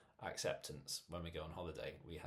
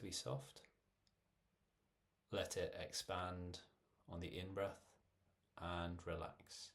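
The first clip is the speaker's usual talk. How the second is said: measured meditation voice with long pauses; guided meditation. Real, not acted. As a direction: guide listeners gently through breathing, phrase by phrase. Leads a guided breathing meditation.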